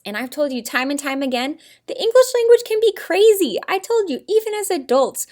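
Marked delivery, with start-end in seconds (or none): 1.9-5.3 much higher, louder emphatic complaint; mock exasperation. Shown as a demonstration. Mock exasperation at English spelling.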